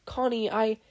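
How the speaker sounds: high, breaking voice; choked-up boy. Acted. Steven reaching towards Connie, high and choking up. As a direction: reach for her and falter.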